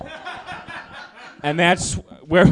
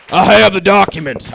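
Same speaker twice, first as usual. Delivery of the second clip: put-on voice, insistent; lightly voiced crank; conspiracy theorist; 5 dB louder than usual. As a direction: push the claim like a crank. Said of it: "impression of a conspiracy theorist".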